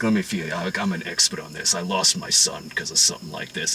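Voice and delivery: low, soft creepy intimacy; lightly voiced menacing tenderness; creepy Whitey. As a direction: mob boss looming over the sick wife. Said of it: "he's like".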